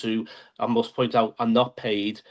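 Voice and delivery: ordinary talk, nothing marked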